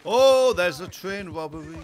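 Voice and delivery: loud, drawn-out excited oh; excited discovery. Real, not acted. Gamer spotting a train robbery, loud and excited. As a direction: call it out thrilled and slow.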